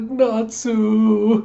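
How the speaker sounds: high, drawn-out cry of a name; mock tearful wail. Brother given a new wallet by his sister, mock tearful wail. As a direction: wail it like an overwhelmed big brother. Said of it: "he's just crying his tears".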